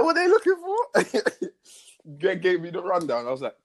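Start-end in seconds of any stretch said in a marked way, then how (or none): 0.0-1.5 high, slow, laughing put-on voice; a lightly voiced baffled self, laughing; mock confused question. Retelling his own confused question.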